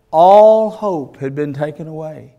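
preacher's loud lament; emphatic preaching. Acted. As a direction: hammer the word all home. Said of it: "It said all hope had been taken away".

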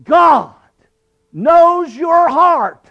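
loud, high, drawn-out pulpit cry; thundering warning, each word stretched high; Granny Effie. Retold or imitated. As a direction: imitate a preaching granny calling out slowly. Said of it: "she'd stand in the pulpit and she'd say".

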